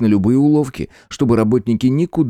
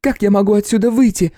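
indignant woman, higher; angry; 13 semitones higher than usual. A narrator's reading. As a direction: scold him sharply. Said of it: "сердито ответила Нуй".

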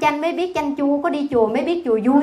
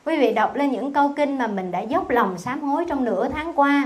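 ordinary delivery

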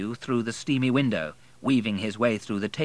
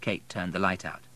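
plain reading voice

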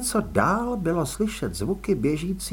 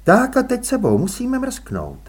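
put-on higher, brisk no-nonsense young woman; young woman, firm; 8 semitones higher, 4 dB louder than usual. A narrator's reading. Růžena urges Elánius back, firm young woman. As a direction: voice Růžena as sharp and sure.

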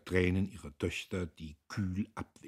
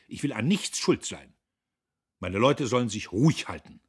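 loud, fast, raised voice, lecturing; Dietrich's blustering tirade; 6 semitones higher, 9 dB louder than usual. A narrator's reading. Factory owner scolds his foreman, loud and fast. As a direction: scold the worker from up high.

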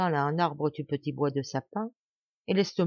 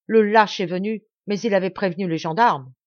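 loud, indignant accusation; exclaiming; 2 semitones higher, 7 dB louder than usual. A narrator's reading. Maurice accusing his rival of betrayal, exclaiming loudly. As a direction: cry out the accusation. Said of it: "s'écria Maurice".

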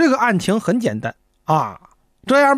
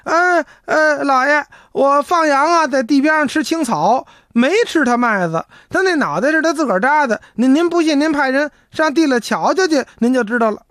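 higher, urgent pleading to the magistrate; mock clamor; 8 semitones higher than usual. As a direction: blurt it all out at once to defend yourself. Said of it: "一上堂就嚷嚷".

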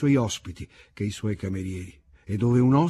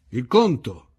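a lightly voiced call for the bill, a touch louder; mild narrated shout; 6 dB louder, slower than usual. A narrator's reading. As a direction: call across the room for the bill. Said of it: "gridò mio suocero".

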